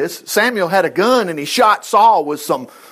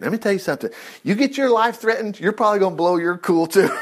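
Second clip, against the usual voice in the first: ordinary talk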